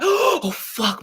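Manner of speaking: high startled cursing; shocked. Retold or imitated. Woman retells a man's unwanted touch, startled. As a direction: yelp it, caught off guard.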